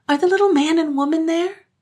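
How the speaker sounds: put-on high, loud eager child voices; eager children. Children asking the village girl, high and eager. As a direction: ask eagerly like small girls.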